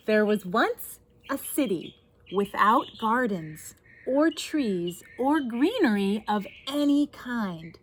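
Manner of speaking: put-on lively read-aloud to children; story narration. A narrator's reading. Reads picture book aloud.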